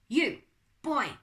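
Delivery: put-on loud, high French-accented call; French butler hailing someone. A narrator's reading. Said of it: "It was a French accent".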